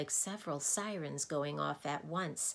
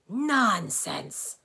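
loud, higher indignant roar; 5 semitones higher, 10 dB louder than usual. A narrator's reading. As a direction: roar the word dismissively. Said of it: "the doctor roared".

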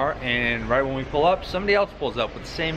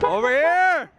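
high, loud call across distance; 5 dB louder, slower than usual. Real, not acted. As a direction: shout to get his attention. Calling friend across parking lot.

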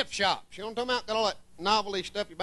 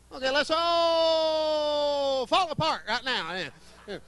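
slow, laughing gleeful shout; car parts cheering their breakdown together; drawn out with a laughing shout; 3 semitones higher, much slower than usual. Pretend.

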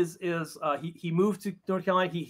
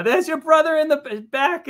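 put-on mom in a much higher, louder New York accent; 12 semitones higher, 5 dB louder than usual. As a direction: point out the son on stage in a New York accent. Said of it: "gave her a New York accent".

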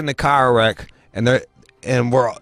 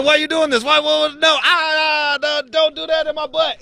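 very high, wailing objections; acted panicked protest. Injured patient begging medics to stop, high panicked wail. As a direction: plead in a high mock panic.

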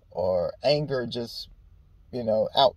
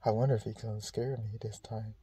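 mild hushed wondering; 2 semitones lower, 12 dB quieter than usual. Retold or imitated.

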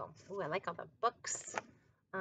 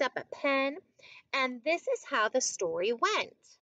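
measured picture-book narration, fuller voice than the chat; story reading to children; 3 semitones higher, 8 dB louder than usual. A narrator's reading. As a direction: read it out clearly for young listeners.

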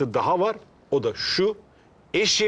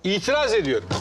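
higher-pitched courtroom objection; American-film objection; 6 semitones higher than usual. Acted. Jacket owner objecting before the makeshift judge, American-film objection. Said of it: "Amerikan filmi öyle itiraz ediyorum".

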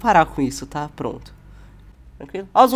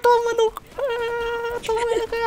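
squeaky mock cursing.

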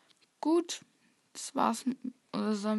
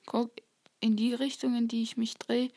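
ordinary talk, nothing marked

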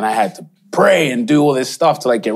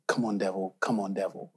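hushed summoning; 3 semitones lower, 12 dB quieter than usual. Retold or imitated. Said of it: "and I was like".

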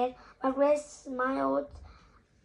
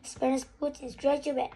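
usual speaking voice

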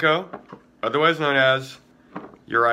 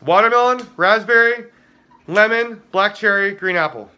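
higher, louder flavor roll call; excited listing; 5 semitones higher, 4 dB louder than usual. Real, not acted. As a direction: announce each flavor with gusto.

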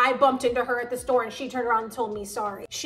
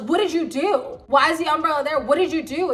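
mock blame, pitch jumping on each question; retold accusation, pressing for an answer; blaming husband; 4 semitones higher, 5 dB louder than usual. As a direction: imitate an accusing husband, higher and louder. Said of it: "was blaming her".

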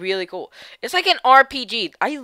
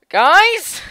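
shouting; 10 semitones higher, 9 dB louder than usual. Real, not acted. Calling out to viewers.

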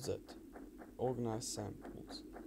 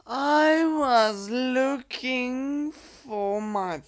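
much higher, slow and stretched; high drawn-out squeal; 11 semitones higher, 12 dB louder, much slower than usual. Real, not acted. Gamer stretching words in a high, slow squeal.

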